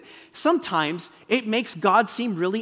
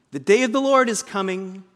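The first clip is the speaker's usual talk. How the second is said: usual speaking voice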